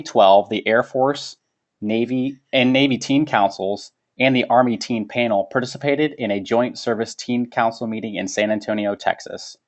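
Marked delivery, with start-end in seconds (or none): none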